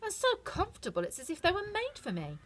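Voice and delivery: put-on a girl thrilled with new shoes; delighted Dorothy. A narrator's reading. Dorothy tries on the shoes, delighted. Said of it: "she said".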